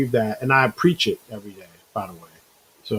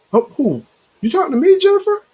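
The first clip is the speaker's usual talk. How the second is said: much higher, louder playful disbelief; mock surprise; 5 dB louder, faster than usual. Real, not acted. Answering a viewer, mock surprised much higher and louder. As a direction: act flattered and astonished at once. Shocked a chatter addresses him.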